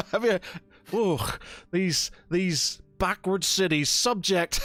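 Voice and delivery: high, slow, laughing scorn; father ridiculing his son's new system; scoffing father. Acted. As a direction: sneer at those backward cities. Father mocks the son's idea.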